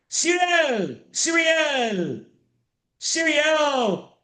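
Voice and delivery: sing-song high call of the name; teacher calling a student back from breakout rooms. Real, not acted. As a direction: call out to a student across the room, pitched high.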